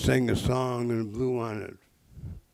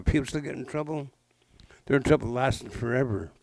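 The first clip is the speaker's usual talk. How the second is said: ordinary delivery, nothing marked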